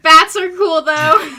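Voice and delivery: loud laughing shout. Real, not acted. Joking shout.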